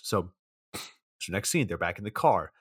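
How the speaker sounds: ordinary talk, nothing marked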